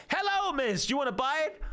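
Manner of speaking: high, eager salesman greeting a caller; TV shopping host. Acted. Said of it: "We have a caller".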